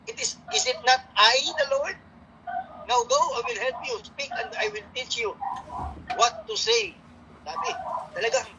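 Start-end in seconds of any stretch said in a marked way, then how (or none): 0.1-7.0 God's voice. Retold or imitated. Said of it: "The Lord said to him".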